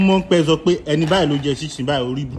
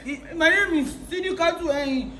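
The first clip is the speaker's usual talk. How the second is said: much higher squeaky mimicry; high mocking voice; 3 dB quieter, slower than usual.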